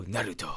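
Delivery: Naruto said low and soft with a tapped r; show's pronunciation. Retold or imitated. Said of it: "They go".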